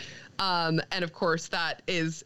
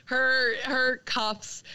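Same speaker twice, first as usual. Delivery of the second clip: ordinary delivery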